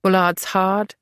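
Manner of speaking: usual speaking voice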